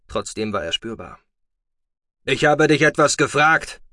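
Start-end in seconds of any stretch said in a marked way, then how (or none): 2.2-3.8 loud, high furious demand; angry shout. Acted. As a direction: bark the question at the boy. Foster father demands an answer.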